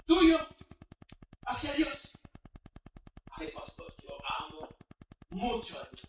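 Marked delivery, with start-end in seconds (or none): 3.3-6.0 much softer, coy churchgoer voice; mock devoted churchgoer. Lukewarm believer answering the pastor, mock coy and soft. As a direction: play the flustered believer, hushed.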